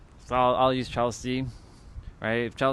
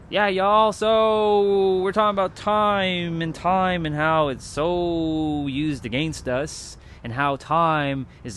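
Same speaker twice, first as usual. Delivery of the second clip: stage speech demo; 3 semitones higher than usual. Shown as a demonstration. Demos his on-stage speaking.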